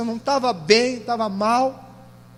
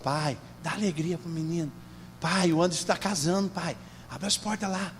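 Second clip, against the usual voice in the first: low, soft plea to a father; murmured, low-pitched appeal; hushed pleading prayer; 6 semitones lower, 9 dB quieter than usual. Shown as a demonstration. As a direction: pray quietly and low, as if asking Dad. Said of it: "fica assim, ó".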